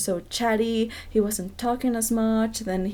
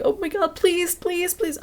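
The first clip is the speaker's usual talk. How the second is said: high, pleading whine in imitation; mock begging; 10 semitones higher than usual. He kept insisting to her, imitated high-pitched begging. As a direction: beg in a much higher voice, mimicking him. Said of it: "was begging me".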